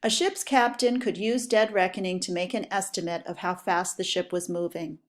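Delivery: normal talking voice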